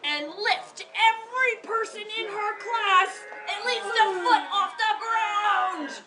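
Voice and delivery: storyteller wowing the class; building excitement. A narrator's reading. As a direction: sell the amazing feat to the kids. Teacher reads story with emphasis.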